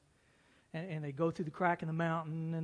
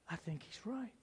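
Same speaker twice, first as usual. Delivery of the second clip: soft whispered aside; 12 dB quieter than usual. Real, not acted. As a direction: breathe it almost unvoiced. Quiet aside after quote.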